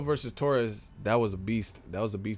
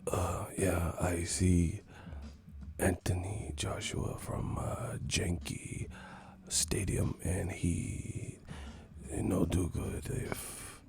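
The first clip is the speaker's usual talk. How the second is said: soft, low, halting half-whisper; mock boxer interview, hushed and accented; Miguel Cotto impression; 4 semitones lower, 8 dB quieter, slower than usual. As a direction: murmur slowly with long hesitations. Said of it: "Cotto sounding motherfucker".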